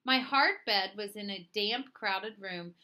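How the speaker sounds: ordinary delivery, nothing marked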